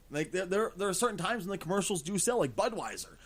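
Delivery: usual speaking voice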